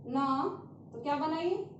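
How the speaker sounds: ordinary talk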